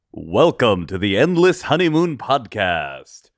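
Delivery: slow, drawn-out announcer intro. Acted. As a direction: stretch it like a radio host.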